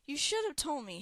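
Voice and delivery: high-pitched reproach; worried scolding. A narrator's reading. Worried Hera scolds Mud.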